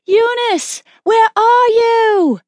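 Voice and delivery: high, long-drawn wail; terrified calling. A narrator's reading. Mother calling for daughter lost in the throng, terrified wail. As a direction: call desperately for a lost child, stretched out high.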